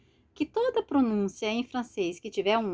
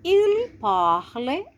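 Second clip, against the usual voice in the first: Il parle français said slowly, with Il lifted high; slow French example with a raised Il; slow model sentence; 6 semitones higher, 5 dB louder, much slower than usual. Shown as a demonstration. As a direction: read it out slowly like a language teacher.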